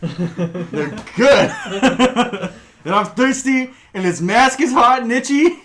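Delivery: high, loud whine with laughs; laughing protest. Real, not acted. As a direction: laugh through a playful complaint. Defending a pumpkin spice craving.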